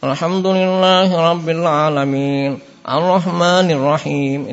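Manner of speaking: slow Alhamdulillahirabbil recited through the nose; wrong nasal Arrahmanirrahim; nasal recitation. Shown as a demonstration. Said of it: "Ini suara apa? Suara hidung".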